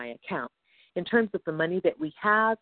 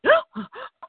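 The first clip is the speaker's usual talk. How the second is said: loud high startled gasp; mock gasp of panic; sharp gasp; 5 dB louder than usual. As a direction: gasp in sudden shock. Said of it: "we're like".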